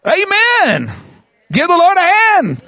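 preacher rallying the crowd to applaud; preacher calling out. Real, not acted. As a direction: rouse the crowd to clap. Congregation called to applause.

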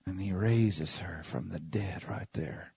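slow, hushed whisper; awed whisper. Acted. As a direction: breathe it out in wonder. Awed hush at the miracle.

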